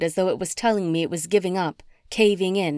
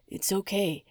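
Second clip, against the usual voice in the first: softer gentle reassurance; calm her in the midnight hours; soothing hush; 7 dB quieter than usual. A narrator's reading. Oscar comforting his crying fiancée, soft.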